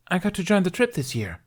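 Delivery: put-on high, bold girl's voice; cocky Viking girl. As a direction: brag like a tough young thief. Kamikaze voiced by narrator.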